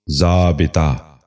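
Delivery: ضابطہ drawn out slowly as "zaa-bi-taa"; correct pronunciation. Shown as a demonstration. Teacher repeating for viewers, slow correct pronunciation. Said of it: "دوبارہ سنیے".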